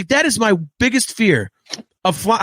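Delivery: ordinary talk, nothing marked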